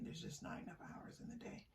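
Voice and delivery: soft whisper, as the tired inner thought; hushed sigh of too little time. Pretend. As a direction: whisper it like a worry kept to yourself. Voicing the viewer's stressed thought.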